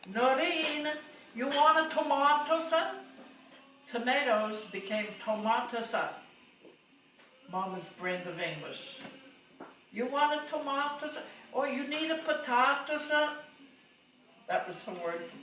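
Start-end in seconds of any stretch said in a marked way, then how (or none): 0.1-3.0 high, loud call up the stairs; Italian mother calling upstairs to daughter-in-law, loud and high; yelling up. Retold or imitated. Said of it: "she'd yell, yell up to the second floor". 9.9-13.4 high, loud call up the stairs; Italian mother calling upstairs to daughter-in-law, loud and high; yelling up. Retold or imitated. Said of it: "she'd yell".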